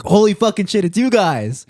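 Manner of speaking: high, loud excited shout; retold yell. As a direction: scream it like you spotted celebrities. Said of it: "starts yelling at us".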